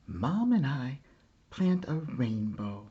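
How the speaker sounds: plain reading voice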